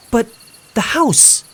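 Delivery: frantic protest; desperate cry. Acted. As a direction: cry out in disbelief. April cries out in protest.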